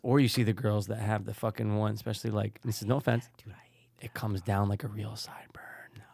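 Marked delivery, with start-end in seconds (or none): 3.4-6.0 unvoiced whisper. Real, not acted. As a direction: whisper it like a secret. Confiding about hating girls' sideburns.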